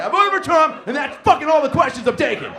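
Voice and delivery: high, loud defiant yell through laughter; mock defiant shout. Comic mocking Brett's answer, hammed-up defiant shout. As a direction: bark it like a proud, defiant answer. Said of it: "you were just like".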